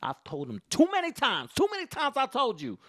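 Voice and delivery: high, loud mock scolding; exasperated mom. Mom scolds her son before his friends, high and loud. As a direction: cry out as a mom at her limit. Imitated mom at end of patience.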